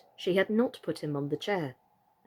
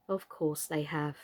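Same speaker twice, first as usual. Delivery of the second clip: soft, lower, kind but wooden; 5 semitones lower, 7 dB quieter than usual. A narrator's reading. Totty soothing Mister Plantagenet in a soft wooden voice. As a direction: reassure him gently, stiff as wood. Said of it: "in her kind wooden voice".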